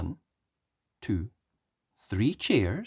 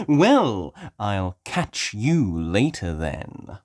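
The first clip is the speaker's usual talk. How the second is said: slow sly drawn-out wolf; a wolf hinting at his appetite; Mister Wolf voice; 6 semitones higher, 8 dB louder, much slower than usual. Acted. Mister Wolf answers Daddy Pig, slow sly voice.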